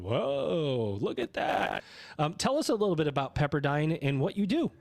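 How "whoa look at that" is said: drawn-out, swooping whoa of mild awe; awed amazement. Acted. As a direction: act out a visitor gawking in wonder. Acts out awed campus visitors.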